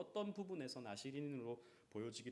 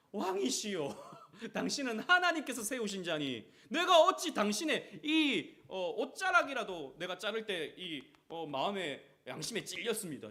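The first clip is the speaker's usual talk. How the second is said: mock flattery; 6 semitones higher, 10 dB louder than usual. Mimicking David flattering Saul.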